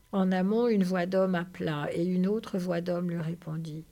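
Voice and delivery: ordinary delivery, nothing marked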